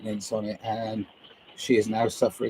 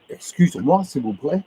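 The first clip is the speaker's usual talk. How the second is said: higher, rising mangled French; mock English tourist; 3 semitones higher than usual. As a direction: lightly act a tourist trying French. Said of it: "The English are so ignorant".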